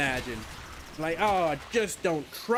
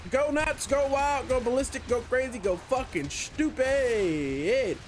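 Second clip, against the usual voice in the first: excited rallying cry, higher; hyped up; 7 semitones higher, slower than usual. Real, not acted. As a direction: cheer it on like a hype man. Hyping up a new turret.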